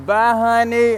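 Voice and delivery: high, loud sing-song farewell; teasing goodbye. Retold or imitated. Woman driving off teases him with a high, loud bye. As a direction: wave him off sweetly, mocking. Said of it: "she was like".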